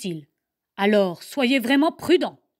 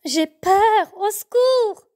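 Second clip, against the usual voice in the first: high, loud scared little bear calling for help; frightened cry; 10 semitones higher, 6 dB louder, slower than usual. Acted. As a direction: cry out in panic from behind the rock. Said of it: "S'exclame Bloom". (cri de peur, très aigu et fort)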